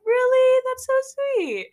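retold delighted surprise; surprised delight. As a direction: gasp it out in happy disbelief. Surprised by free touch-up offer.

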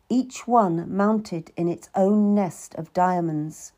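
ordinary delivery, nothing marked

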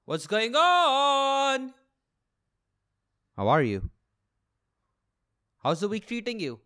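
normal talking voice

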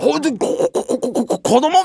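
stuttering in disbelief; stammer, caught off guard; stammering shock. Retold or imitated. (動揺してどもる)

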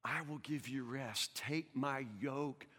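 slow, soft voice of Jesus inviting; tender scripture. Acted. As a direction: offer rest gently and slowly.

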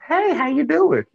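high, slow, sing-song hello; drawn-out high mock greeting; mock cheery greeting. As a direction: breeze in with a fake-friendly hi. Acting out a casual greeting.

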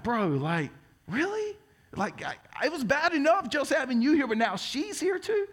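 high, disbelieving whine; incredulous. Retold or imitated. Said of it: "And I'm like".

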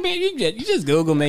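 high, laughing country accent imitation. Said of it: "talk in her little country accent".

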